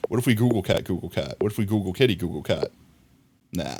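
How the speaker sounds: usual speaking voice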